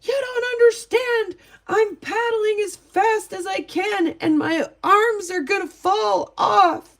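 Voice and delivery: put-on a scared girl pleading she's paddling her hardest, high and loud; panicked girl. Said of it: "And you could hear her. She's like".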